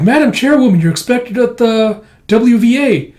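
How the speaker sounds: urgent aide. Retold or imitated. Aide calling chairwoman to her appointment, urgent. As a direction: call out officiously to the boss.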